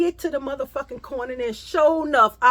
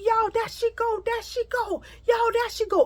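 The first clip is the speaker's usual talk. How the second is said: high mocking call of the girls; sing-song taunt, pitched way up; 12 semitones higher than usual. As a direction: girls jeering from the alley. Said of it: "She like".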